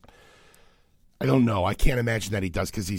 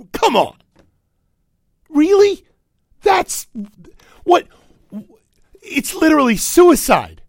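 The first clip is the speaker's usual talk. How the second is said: exasperated; 14 dB louder than usual. Real, not acted. Exasperated rant at the story.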